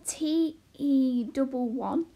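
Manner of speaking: very slow, letter by letter; spelling out. A narrator's reading. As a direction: decipher each letter carefully. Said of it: "began to spell out the letters".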